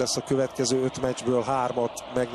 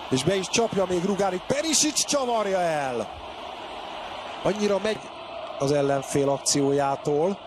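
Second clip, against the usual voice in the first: normal talking voice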